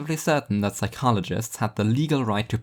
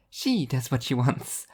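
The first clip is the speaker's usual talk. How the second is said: higher angel voice, a touch slower; angel correcting; 4 semitones higher than usual. Acted. Angel clarifying to Martin, higher and prim. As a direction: correct her primly. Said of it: "Arbitrage clarified".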